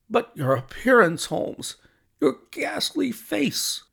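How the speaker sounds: high-pitched, dismayed outburst; aghast friend, high and drawn out at the end; appalled exclamation. A narrator's reading. Watson appalled at Holmes's haggard face.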